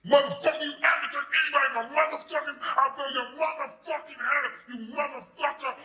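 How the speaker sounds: screaming high, loud threat; furious threat. Retold or imitated. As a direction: scream it with a gun in hand. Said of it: "So he goes".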